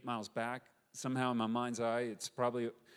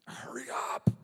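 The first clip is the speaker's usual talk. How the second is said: urgent whisper. Retold or imitated. Eleazar's men at the enemy well, urgent whisper. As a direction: hiss it urgently under your breath. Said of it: "He's like, you know".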